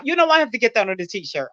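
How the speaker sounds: normal talking voice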